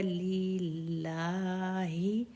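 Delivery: लिल्लाहि with the final vowel drawn out long and rising; long standing zabar. Shown as a demonstration. Teacher drawing out लिल्लाहि for the students. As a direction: stretch the ला in लिल्लाहि slowly. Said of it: "खड़ा ज़बर है".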